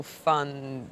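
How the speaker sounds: ordinary delivery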